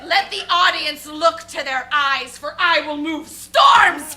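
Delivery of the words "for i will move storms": slow loud hammy proclamation; grand booming declaration. Acted. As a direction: boom it out like a ham actor. Actor boasting grandly.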